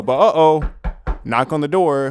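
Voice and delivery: dramatic uh-oh. A narrator's reading. Telling viewers his mom knocked, loud mock alarm. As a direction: sell the sudden twist like a sitcom beat.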